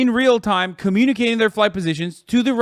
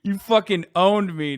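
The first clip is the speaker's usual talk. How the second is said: normal talking voice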